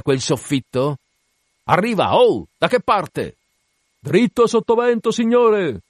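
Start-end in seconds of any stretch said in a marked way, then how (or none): 1.6-5.8 high, urgent shipboard hail; calling out. Acted. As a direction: call it across the deck. Urgent calls between captain and crew.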